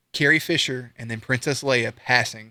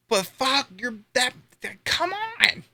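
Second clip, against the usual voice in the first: exasperated outburst; 10 semitones higher, slower than usual. Real, not acted. Exasperated outburst about the movie.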